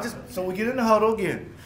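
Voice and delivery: usual speaking voice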